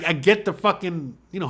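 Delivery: ordinary delivery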